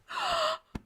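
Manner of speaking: very high wheezing intake of breath; gasp mid-laugh. Real, not acted. Host overcome by a listener's joke, gasping from laughter. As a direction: gasp for air between fits of laughter. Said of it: "I'm gonna snort".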